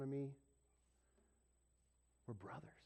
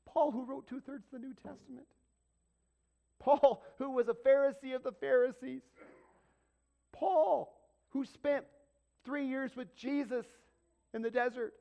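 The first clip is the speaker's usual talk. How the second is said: rising shouted list, each Paul higher and louder; full-force preacher crescendo on Paul; building preached shout; 11 semitones higher, 10 dB louder than usual. Real, not acted. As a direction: thunder out each Paul, climbing. Preacher builds Paul's credentials.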